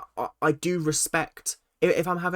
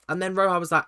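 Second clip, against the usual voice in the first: usual speaking voice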